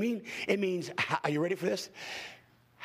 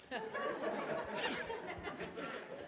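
breathy laugh; 10 semitones higher, 11 dB quieter than usual. Real, not acted. Laughs after tongues joke.

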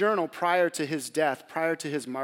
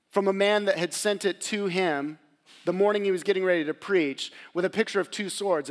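usual speaking voice

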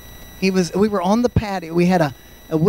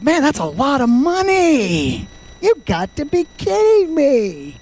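higher, slow, indignant disbelief; mock angry friend; 7 semitones higher than usual. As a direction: act out the friend's outrage. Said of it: "he was mad, I mean, he was angry".

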